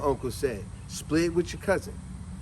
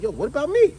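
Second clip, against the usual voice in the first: put-on higher, louder indignant kid; mock protest; 10 semitones higher, 6 dB louder than usual. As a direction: complain about being left out. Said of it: "You're like".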